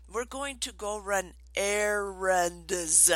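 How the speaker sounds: slow, with "errands" stretched out syllable by syllable; over-enunciate "errands" slowly for a small child; retold painstakingly slow explanation; really slow. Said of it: "I said it really slow".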